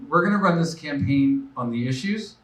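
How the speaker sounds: ordinary talk, nothing marked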